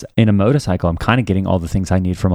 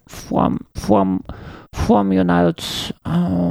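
high, halting attempt at a foreign accent; groping for her foreign accent, pitched high and slow; Malady; 10 semitones higher, much slower than usual. Man retrying his ex's speech for Hallie, foreign accent. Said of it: "something like that, like".

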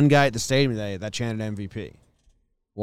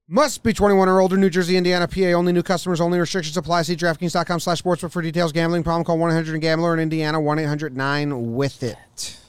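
rapid-fire ad disclaimer; fast legal disclaimer; 4 semitones higher, faster than usual. Acted. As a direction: rattle off the fine print.

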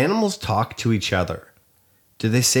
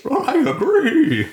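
high, drawn-out horse whinny; whinny the words like a horse; slow, high neighing voice; talking horse; 3 dB louder, slower than usual. Pretend. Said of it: "Like, is that what they do?".

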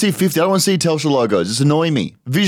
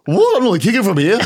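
high startled yelp, higher through the line; retold shock; 6 semitones higher than usual. Footballer retelling his confusion on the field, high mock surprise. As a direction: play the disbelief as a squeaky outburst.

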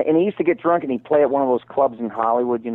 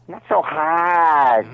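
slow, stretched comic complaint; Joe Besser impression; 4 semitones higher, 3 dB louder, much slower than usual. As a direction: drag out the last word like a whiny comedian. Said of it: "Oh, that's Joe Besser".

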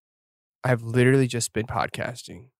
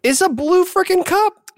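much higher, exasperated cry; high indignant protest; 4 dB louder than usual. Real, not acted. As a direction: protest it shrilly. Defending the cup.

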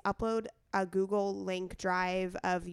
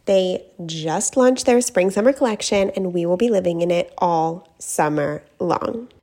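loud, polished personal endorsement; ad read; 15 dB louder than usual. Acted. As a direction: pitch the brand clearly to listeners. Reads a sponsor ad.

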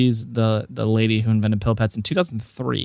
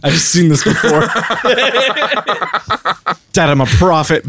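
laughing mock kid; 4 semitones higher than usual. Mocking young Jaron, laughing.

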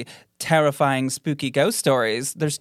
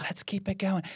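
ghost voice; 5 semitones higher, 8 dB quieter than usual. Retold or imitated. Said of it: "I'm picturing".

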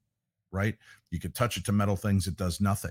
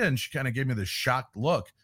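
ordinary talk

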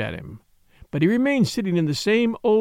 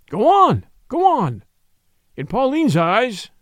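imploring; 4 semitones higher than usual. Acted. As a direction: press him harder with each go on. Priest implores confession, rising.

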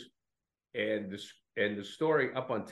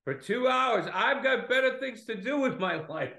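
much higher and louder, fed up; hammed-up exasperation with a tedious friend; mock indignant rant; 11 semitones higher, 3 dB louder than usual. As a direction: pitch up into a put-upon squeal. Retold friend's exasperated complaint.

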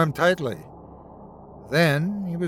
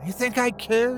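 higher-pitched, lightly growled retort; mild growl; 9 semitones higher than usual. A narrator's reading. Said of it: "Donald growled at her".

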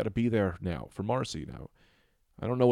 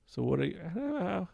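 normal talking voice

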